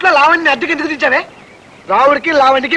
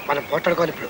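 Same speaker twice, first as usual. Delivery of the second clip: hushed aside; 13 semitones lower, 12 dB quieter, faster than usual. Real, not acted. Hushed plan before staged fight.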